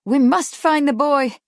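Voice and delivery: high, loud insistent cry; loud, emphatic plea. A narrator's reading. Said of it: "Kate cried".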